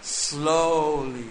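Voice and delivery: ordinary talk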